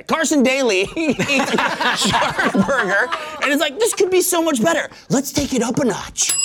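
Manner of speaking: hammed-up chef voice. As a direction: ham up a dramatic foodie. Improv pitch, acted quote.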